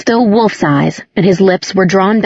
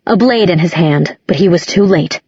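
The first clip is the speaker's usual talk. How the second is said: plain reading voice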